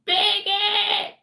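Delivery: high, loud shriek of an insult; screamed insult. Retold or imitated. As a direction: shriek it at the top of your lungs. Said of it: "He's like".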